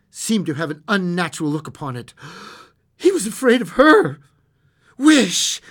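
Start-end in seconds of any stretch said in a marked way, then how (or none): 3.0-4.2 high, loud burst of dread; fearful narration. A narrator's reading. Narrator dramatizes sudden fear. 5.0-5.6 high, loud command; strong-voiced cry. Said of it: "she cried in a strong voice".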